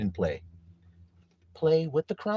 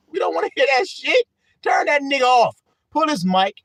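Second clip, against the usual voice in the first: high, raised heckler's shout; heckling; 9 semitones higher, 5 dB louder than usual. Pretend. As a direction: shout the bad singer off stage.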